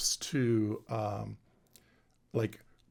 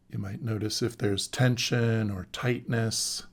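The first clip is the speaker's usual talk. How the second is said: guided meditation. Acted. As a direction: guide them into the body, calmly and unhurried.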